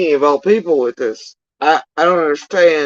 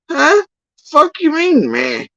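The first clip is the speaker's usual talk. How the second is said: incredulous outrage; 13 semitones higher, slower than usual. Retold or imitated. Outraged reply to dismissive searcher.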